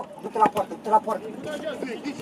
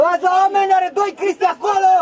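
raised, higher-pitched sideline shout; urgent shout; 4 semitones higher than usual. Real, not acted. Coach warning players about two opponents there, urgent shout. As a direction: call it out across the pitch.

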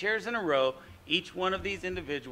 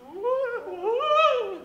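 high, louder woo call; plankton woo; 8 dB louder than usual. Acted. Teacher voicing the plankton's made-up sound. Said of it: "this sound is just kind of made up and goes".

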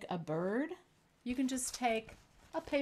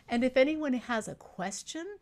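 ordinary talk, nothing marked

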